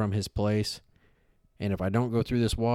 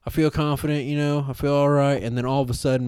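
ordinary talk, nothing marked

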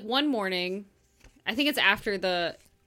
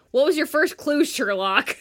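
loud, higher sarcastic retort; annoyed inner voice; 6 semitones higher, 8 dB louder than usual. Acted. Host voices the heroine's silent comeback, loudly sarcastic. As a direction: throw it out with mock exasperation. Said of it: "Her internal thing".

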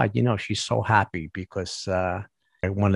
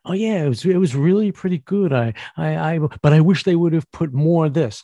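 higher, fake-polite praise; mock contestant critique; 6 semitones higher, 3 dB louder than usual. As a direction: sound nice while hiding spite. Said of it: "What did you think of".

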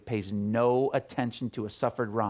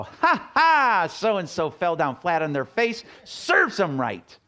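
high, loud mocking cackle that turns smug; gloating mock; 5 semitones higher, 5 dB louder, slower than usual. Pastor mocking someone gloating at another's fall, loud and high. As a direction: crow over someone's fall. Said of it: "love doesn't go".

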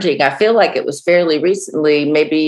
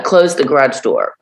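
ordinary delivery

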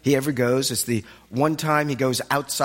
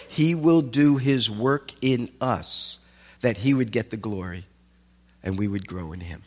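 normal talking voice

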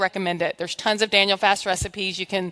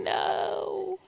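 flesh saying no; 11 semitones higher, 8 dB quieter than usual. Pretend. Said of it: "my flesh is going".

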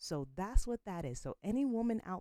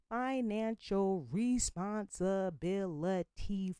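slow, louder, leaning on never; stressed warning; 3 semitones higher, 5 dB louder, slower than usual. Real, not acted. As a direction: hammer each word to warn the women. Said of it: "I can't stress it enough".

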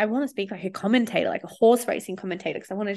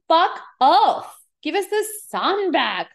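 higher, louder mock outburst at the weather; fed-up outburst; 8 semitones higher, 6 dB louder, slower than usual. Fed-up with the cold weather, a higher louder fake yell. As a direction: yell it at the grey sky, half joking.

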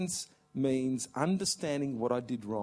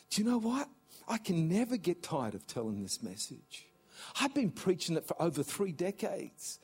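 normal talking voice

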